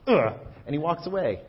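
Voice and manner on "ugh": a mock groan of disgust, louder; loud disgusted ugh. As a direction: groan like a sulky toddler. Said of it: "and he's like".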